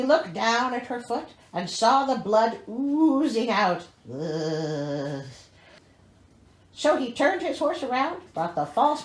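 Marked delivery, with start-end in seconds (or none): 4.1-5.2 disgusted ugh. Real, not acted. Disgust at blood.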